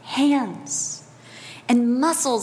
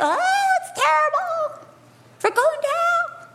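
much higher, hammed-up wailing panic; mock panic; 14 semitones higher than usual. Believers panicking in crisis, high mock despair. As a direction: play the despairing believer, shrill with fear. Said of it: "sometimes we get".

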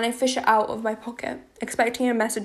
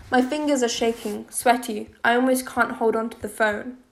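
usual speaking voice

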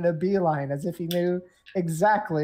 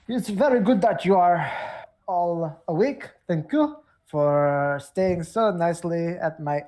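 put-on welcoming host in a stagey Romanian accent. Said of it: "gave all the Barovians this accent".

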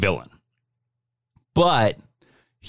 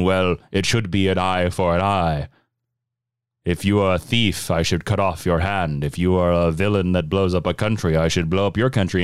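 lower, grave lecturing villain; 7 semitones lower than usual. Retold or imitated.

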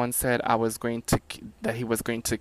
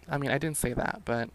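ordinary talk, nothing marked

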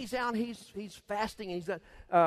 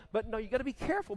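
ordinary talk

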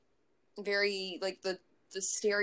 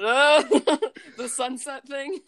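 laughing; 3 semitones higher, 10 dB louder than usual. Real, not acted. Hums the wrong song laughing.

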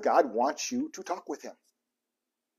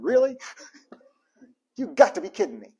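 exasperated disbelief; 4 semitones higher than usual. Pretend. Exasperated complaint to God.

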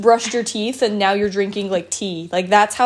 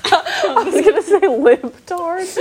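laughing; 8 semitones higher, 4 dB louder than usual. Real, not acted. Laughing confession.

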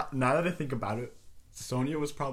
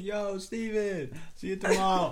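cheery goodbye; 7 semitones higher, slower than usual. Retold or imitated.

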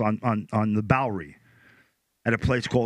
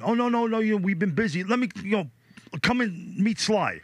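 much higher, eager and breezy; eager bodyguard brush-off; 10 semitones higher than usual. Retold or imitated. Bodyguard waving off the missed call, high and eager. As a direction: brush it off brightly, pitched well up. Bodyguard excuses not calling Chuck.

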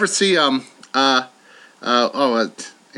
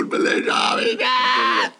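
breathing in; 5 semitones higher than usual. Pretend. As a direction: gasp the words in. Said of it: "how about breathing in".

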